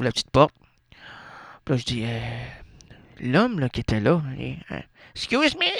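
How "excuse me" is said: high mock Excuse me; man in the doorway. Caught at the little door, he asks, mock high. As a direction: squeak it out like a startled tourist. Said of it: "j'ai dit, l'homme qui était là".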